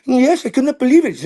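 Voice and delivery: very high put-on mom voice; high mock motherly reply; his mom. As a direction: impersonate your mother answering the teacher. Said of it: "do my mom's voice".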